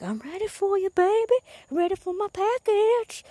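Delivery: very high, singsong mock glee; stoked. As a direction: play up eager anticipation in a high voice. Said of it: "I'm, like, stoked".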